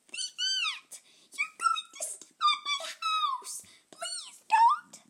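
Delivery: very high, crying plea; tiny ant voice. Acted. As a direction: wail like a tiny ant about to be squashed. Ant begs not to be stepped on.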